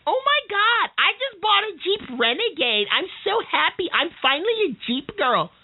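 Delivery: high, gushing valley-girl mimic; mock the giddy crossover buyer.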